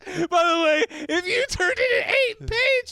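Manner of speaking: very high, loud, laughing squeal; hysterical high voice. Real, not acted.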